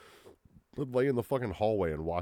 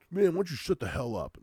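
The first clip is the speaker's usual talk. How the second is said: high, loud hammed-up retort; hammed-up yelled comeback; mock dramatic arguing; 10 semitones higher, 6 dB louder than usual. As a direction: fake an angry TV comeback, pitched up. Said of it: "yelling and arguing".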